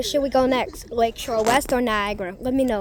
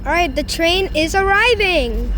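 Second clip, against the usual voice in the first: high, loud and thrilled; bursting with excitement; excited; 9 semitones higher, 5 dB louder than usual. Real, not acted. Train arriving, thrilled.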